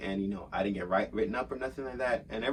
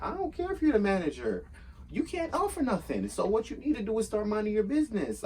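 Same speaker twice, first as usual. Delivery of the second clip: heated, high-pitched clapback; coming in hot; 11 semitones higher than usual. Retold or imitated. Worker tells off the useless manager, coming in hot. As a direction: fire back in a much higher voice. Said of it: "I was coming in hot".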